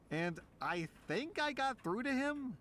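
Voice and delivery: ordinary delivery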